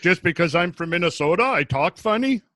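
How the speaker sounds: mock-offended Minnesotan; Minnesota accent. Teased by co-hosts about being from Minnesota, Minnesota accent. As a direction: protest in a played-up Minnesota accent. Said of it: "Doesn't take you long to get it back".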